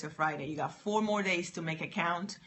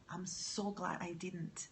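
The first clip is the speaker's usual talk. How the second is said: hushed whisper, slow and private; 8 dB quieter, slower than usual. Real, not acted. Telling the audience she nearly refused her friend, whispered. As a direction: breathe it out as a secret relief.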